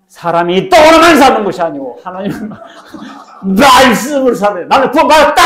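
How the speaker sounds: shouted, high and loud; triumphant shouted confession; bold confession. Pretend. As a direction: proclaim it boldly, full voice. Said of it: "당당하게 고백해야 된다".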